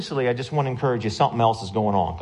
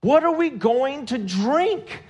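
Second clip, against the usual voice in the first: high, drawn-out whine of protest; exaggerated, stretched-out grumble at their leader; mock complaining; 14 semitones higher, slower than usual. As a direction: imitate a crowd griping, slow and high. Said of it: "the people complained and turned against Moses".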